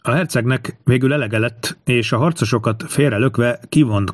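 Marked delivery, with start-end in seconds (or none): none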